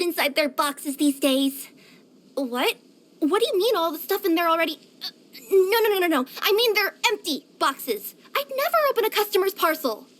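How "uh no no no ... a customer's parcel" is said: hurried string of denials, pitched up; flustered. Acted. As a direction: protest innocence in a fluster. Denies opening customer parcels.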